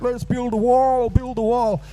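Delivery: high, drawn-out chant in mockery; caricatured rallying cry; mock Southern Americans. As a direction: imitate Southern wall supporters, high and slow. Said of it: "they're like".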